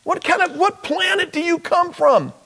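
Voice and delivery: high, mocking incredulity; high-pitched what-planet mockery; mock disbelief. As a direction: act amazed they haven't heard. Mocking the clueless stranger.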